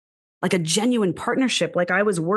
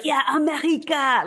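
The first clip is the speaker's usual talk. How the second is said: mock French accent; 7 semitones higher than usual. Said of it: "and I love your French accent".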